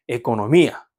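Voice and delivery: 에코노미아 with the stress on 미. Shown as a demonstration. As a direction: punch 미 near the end of 에코노미아. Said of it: "여기가 강세 있는 음절이 되고".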